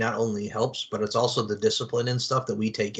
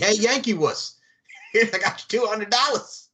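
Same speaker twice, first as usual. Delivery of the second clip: higher-pitched mock lawyer heckle; brash movie lawyer; 7 semitones higher than usual. Lawyer taunting an out-of-town client, hammed-up and higher. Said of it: "Just talk like that to 'em".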